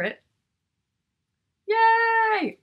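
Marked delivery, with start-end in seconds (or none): 1.7-2.6 high, loud cheer of delight; joyful yay, much higher and louder; excited cheer. Real, not acted. Kids agree to hear book.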